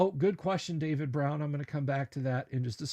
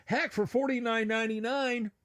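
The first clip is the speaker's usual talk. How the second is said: ordinary talk, nothing marked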